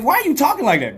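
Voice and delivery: high, loud incredulous question; retold exasperation. Acting out exasperated phone exchange.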